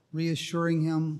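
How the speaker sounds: usual speaking voice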